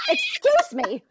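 loud, high-pitched mock demand; hammed-up entitled complainer. Customer mocking her own desk manner, entitled. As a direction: exaggerate the pushy customer, pitch way up. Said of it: "a lot of care and energy".

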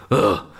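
short, loud mock groan; imitated loud grunt of dismay. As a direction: mimic a fan's sudden "ugh". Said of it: "and you just hear".

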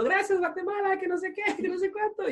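high, soft mock-diva thanks to the crowd; mock singer thanking crowd. Retelling a concert, imitating [name] thanking the crowd, high and soft. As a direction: chirp it like a pop star greeting fans.

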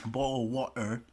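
badly faked celebrity accent; Andrew Tate accent. Podcaster practising a catchphrase, poor Andrew Tate accent. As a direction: have a failed go at Andrew Tate's accent. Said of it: "I have no Andrew Tate accent".

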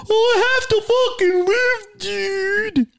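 whiny mock complainer. Mocking people who say they must work, high whiny voice. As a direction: whine like a lazy kid. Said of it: "I hate that answer".

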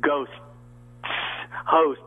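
"ghost" and "host" with a long, crisp final st; voice-class diction on "ghost" and "host"; crisp consonants. Shown as a demonstration. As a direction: hit the st ending of "ghost" and "host". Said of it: "find out what a consonant was".

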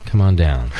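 deep, slow ad announcer; low commercial voice. Pretend. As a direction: sell it like a radio spot. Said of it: "start doing live reads for my commercial".

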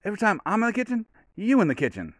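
high-pitched, quick movie-dad voice, dropping at the end; Friday dad impression. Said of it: "those are just the parts I remember".

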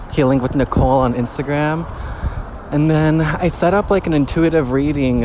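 chatty update while walking back home; talking on a walk. Real, not acted. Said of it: "Yo, I'm on a fucking walk right now".

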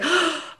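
a quick, soft, relieved gasp; mock gasp. Host imitating people reacting to vulnerability, soft mock gasp. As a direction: gasp lightly as if comforted.

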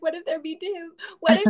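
nervous fretting. Retold or imitated. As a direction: fret aloud over your child, lightly acted. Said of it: "I was especially nervous for my son".

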